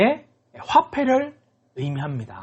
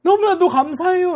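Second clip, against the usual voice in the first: high, gushing acted thanks; overwhelmed gratitude; 7 semitones higher than usual. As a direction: build to an overjoyed, high-pitched thank-you. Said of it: "감격의 눈물을 흘렸을 수도".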